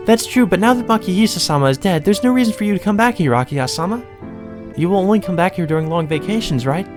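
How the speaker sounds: high-pitched maid pleading her case; put-on high maid voice; polite maid. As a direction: voice a servant high above your own pitch.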